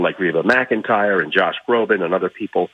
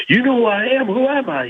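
high, boastful Ali impression; Muhammad Ali; 13 semitones higher than usual. Storyteller voicing Ali boasting to an old man, high and proud. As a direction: crow it like the champ, much higher. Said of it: "He goes".

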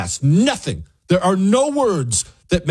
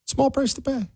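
high, soft sing-song mockery; mocking echo; 11 semitones higher, 6 dB quieter than usual. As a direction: throw the phrase back in a small, high voice. Mocking a played clip's phrase.

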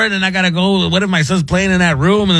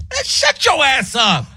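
put-on rude kid; 8 semitones higher, slower than usual. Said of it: "one of them said".

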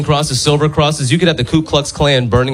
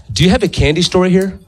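put-on high-pitched little kid question; like a six-year-old; 7 semitones higher than usual. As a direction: ask it like an eager small child. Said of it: "said it like a six-year-old".

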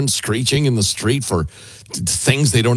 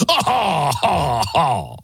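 spelt-out fake "ha, ha, ha, ha"; consumer's mock laugh; 2 semitones higher, much slower than usual. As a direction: laugh sceptically as the consumer, word by word. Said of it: "says the consumer".

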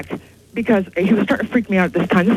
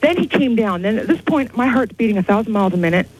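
usual speaking voice

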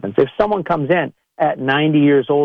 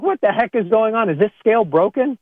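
higher, mock baffled outrage; mock indignant, pitched up; exasperated; 7 semitones higher than usual. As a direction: act stunned at the scale. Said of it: "and you're like".

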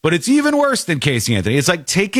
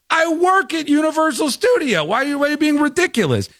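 high, fast and offended insistence; indignant Casey; 14 semitones higher than usual. Retold or imitated. Casey insists to the cops, high-pitched and indignant. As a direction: act insulted that anyone doubts you.

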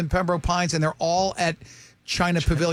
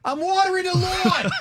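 imitated dad yelling back; 12 semitones higher than usual. Dad yelling back from the lawn, high mock holler. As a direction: call back like a dad busy outside. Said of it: "Like my dad back in the day".

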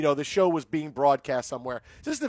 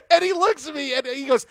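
laughing; 8 semitones higher, 4 dB louder than usual. Real, not acted. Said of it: "laughing while telling the story".

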